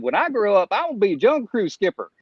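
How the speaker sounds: put-on young [name] voice. Boy telling his mom his big dream, young [name] voice. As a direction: pitch it up like an eager young boy. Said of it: "I love your young [name], [name] voice".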